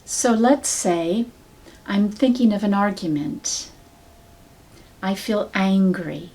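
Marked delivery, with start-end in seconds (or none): none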